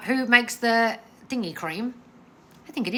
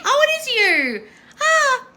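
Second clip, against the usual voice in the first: high, laughing oh of recognition; light up as you spot a familiar name; delighted surprise; 7 dB louder, slower than usual. Real, not acted. Host spotting a viewer she knows in the chat, delighted laughing surprise.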